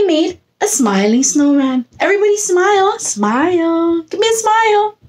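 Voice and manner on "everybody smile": normal talking voice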